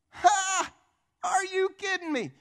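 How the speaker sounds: high, loud scoffing laugh; Satan. Acted. Devil scoffing at rebuke over marriage, high mocking disbelief. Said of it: "the enemy laughs at us".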